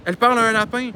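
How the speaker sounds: a touch of loud astonishment; acted amazement. Friend reporting what she saw in the car, amazed. Characters' surprised exchange, acted.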